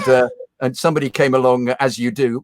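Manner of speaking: normal talking voice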